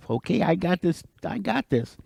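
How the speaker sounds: soft, high, sneaky undertone; gleeful aside to himself. Acted. Turtle laughing to himself behind the beaver, hushed glee. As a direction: say it small and high, privately amused. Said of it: "he's laughing to himself".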